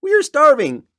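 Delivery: high, pained little turtle voice, near crying at the end; crushed turtle begging for food and rights; groaning Mac. Acted. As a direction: plead up to the king through the pain. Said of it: "groaned Mac".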